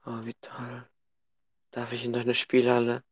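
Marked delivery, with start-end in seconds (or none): 0.0-0.9 breathy mock delight; whispering. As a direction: whisper it like a child.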